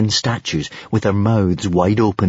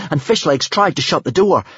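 usual speaking voice

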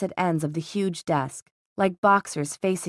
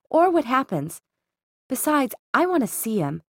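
lightly voiced insistent sister, higher; a touch of headstrong sister in a raised pitch; determined Isabel; 7 semitones higher than usual. Sister arguing with her brother to go in, lightly voiced, insistent.

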